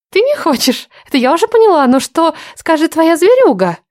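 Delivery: high mocking temptress; taunting woman.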